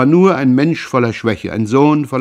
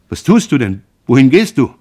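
higher and louder, frightened questions; alarmed outcry; 4 semitones higher, 7 dB louder than usual. A narrator's reading. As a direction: grab her and cry out in alarm. Son grabs mother leaving the room.

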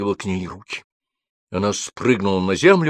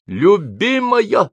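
high, loud, drawn-out call; shouting; 12 semitones higher, 5 dB louder, much slower than usual. A narrator's reading. Alexander calling to his trapped beloved in the dark, shouting. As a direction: cry out to her with all your strength. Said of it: "закричал Александр".

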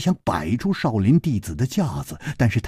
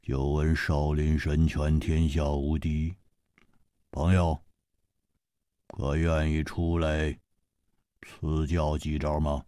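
low, soft, slow and sinister; a low creeping threat; 8 semitones lower, 7 dB quieter than usual. Acted. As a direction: menace him quietly and slowly. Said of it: "阴恻恻地说".

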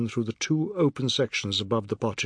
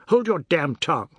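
louder, heated scolding; indignant raised voice; angry rebuke; 4 semitones higher, 5 dB louder than usual. A narrator's reading. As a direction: snap at him to stop. Angry rebuke in family quarrel.

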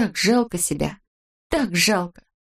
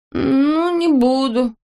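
grudging, higher and drawn out; sullen grumble; 8 semitones higher, 4 dB louder, much slower than usual. A narrator's reading. Boy grudgingly promises girl, sullen grumble. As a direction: give in with a pout. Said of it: "угрюмо буркнул Незнайка".

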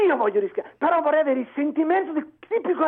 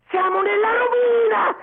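high, drawn-out anguished yell; real impassioned shout; desperate shouting; 4 semitones higher than usual. Real, not acted. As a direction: cry out the alarm in earnest. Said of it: "io non sto gridando perché voglio fare scena".

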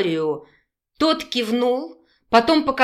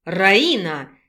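a sharp, low call of Раина; displeased shout; 4 semitones lower than usual. A narrator's reading. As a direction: bark Раина in annoyance. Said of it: "недовольно крикнул мужчина".

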